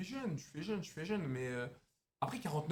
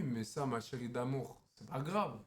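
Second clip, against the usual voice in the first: low, slow flirting voice; low seductive; 6 semitones lower, slower than usual. Acted. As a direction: seduce her with a deep voice.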